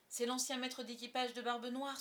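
plain reading voice

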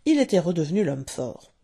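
ordinary delivery, nothing marked

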